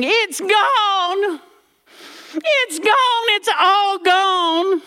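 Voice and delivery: high, slowing panicked granny cry; hammed-up old lady in a panic; mock granny wailing. Granny bursting into the room, high panicked wail.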